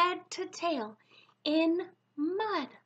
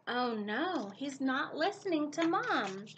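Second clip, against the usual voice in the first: ordinary delivery, nothing marked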